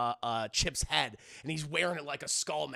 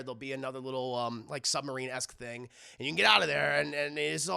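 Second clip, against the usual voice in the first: ordinary delivery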